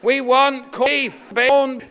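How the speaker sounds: loud, high chanted demand; crowd shout. Acted. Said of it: "And people will shout".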